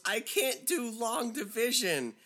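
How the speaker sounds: high, drawn-out imitation; pleading pupil. Seventh-grader answering yelling teacher, high and helpless.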